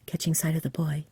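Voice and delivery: plain reading voice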